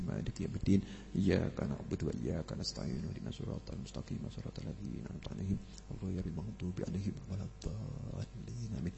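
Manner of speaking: low, hushed Quran recitation; murmured Arabic prayer, low and soft; quiet prayer recitation. Real, not acted. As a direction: recite Al-Fatihah softly under the breath.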